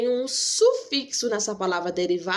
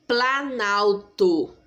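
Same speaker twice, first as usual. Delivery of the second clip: slow, drawn out; much slower than usual. Shown as a demonstration. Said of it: "é uma palavra só".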